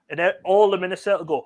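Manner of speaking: ordinary delivery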